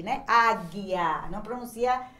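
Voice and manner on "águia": low, loud "Águia", hard G and silent U; careful pronunciation demo. Shown as a demonstration. Teacher proving the U is silent, firm distinct "Águia". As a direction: repeat "Águia" distinctly, no U after the G. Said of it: "não pronuncia o U".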